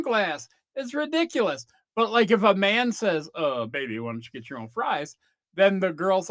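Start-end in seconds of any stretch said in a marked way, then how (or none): 3.3-5.2 low dude voice. Acted. Man voiced asking about fries.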